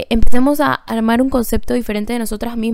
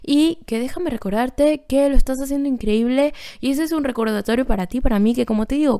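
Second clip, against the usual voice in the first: ordinary talk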